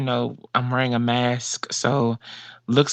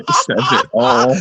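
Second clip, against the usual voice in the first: laughing; 4 dB louder than usual. Real, not acted. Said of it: "you made me cry".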